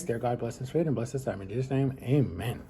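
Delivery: soft, hushed grace before eating; prayer. Real, not acted. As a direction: murmur a quiet mealtime prayer. Saying grace before meal.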